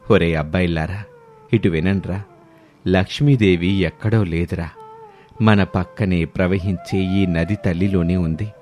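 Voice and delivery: put-on elderly mother-in-law. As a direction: speak like a wise old village matriarch. Mother-in-law addresses villagers.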